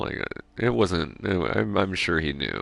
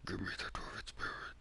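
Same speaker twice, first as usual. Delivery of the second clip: very quiet, whispered metal vocal; hushed metal voice; 15 dB quieter, faster than usual. Shown as a demonstration. Demanding dwarven spirit late at night, whispered metal voice. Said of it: "you've heard my metal voice".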